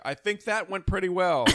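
high, booming speakerphone projection; loud phone voice. Retold or imitated. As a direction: re-enact talking far too loud after a call. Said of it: "still speaking in your phone voice".

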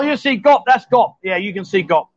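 ordinary talk, nothing marked

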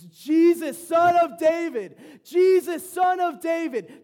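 a drawn-out desperate bellow of a blind beggar; desperate shout. Retold or imitated. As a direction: scream it across the crowd to get his attention. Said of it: "He gets even louder".

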